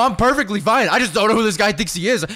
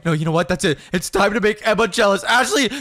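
ordinary delivery

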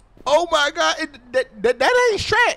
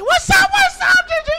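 high, loud hype greeting; excited shout; 5 dB louder than usual. Real, not acted. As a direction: yell hello. Greeting Gingerbread Man again.

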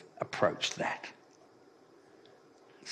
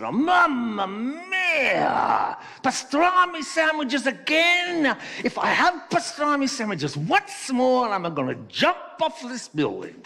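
loud, high exasperated Italian joke voice; over-the-top Italian outburst, slow and loud; Italian character; 14 semitones higher, 5 dB louder than usual. Acted. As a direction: wail it as a hammed-up Italian worker. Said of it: "the Italian opened his lunchbox".

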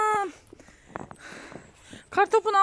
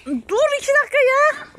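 exasperated; 6 semitones higher, 4 dB louder than usual. Real, not acted. Can't breathe, pleading for pause.

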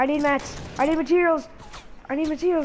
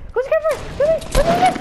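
much higher and louder, thrilled; excited squeal; 11 semitones higher, 4 dB louder, much faster than usual. Real, not acted. Player thrilled by a lucky moment, squealing. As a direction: burst out in sudden delight.

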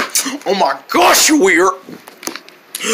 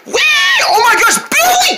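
panicked shout. Acted. Panicked call.

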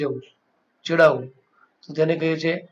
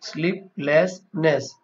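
સ્લીપલેસનેસ said slowly in three separate parts, sleep, less, ness; syllable by syllable; much slower than usual. Shown as a demonstration. Teacher sounding out a new word for insomnia, slowly, part by part. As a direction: break સ્લીપલેસનેસ into its parts with small gaps. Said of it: "તો એને કહેવાય સ્લીપલેસનેસ".

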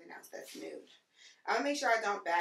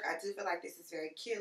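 ordinary delivery

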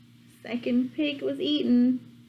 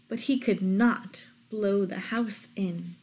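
usual speaking voice

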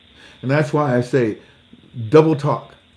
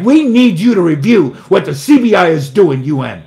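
higher, louder put-on pleading voice; mock official; 5 semitones higher, 8 dB louder than usual. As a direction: imitate the ambassador asking. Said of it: "Oh, like".

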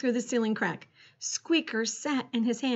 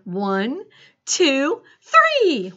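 rising heave-ho count; building countdown; 3 semitones higher, 7 dB louder, much slower than usual. A narrator's reading. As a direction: count from normal voice up to a shout. Counting up to a boost.

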